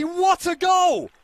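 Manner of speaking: high, slow, roaring shout; goal yell. Real, not acted. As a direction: stretch it out, roaring. Commentator calls a long-range goal.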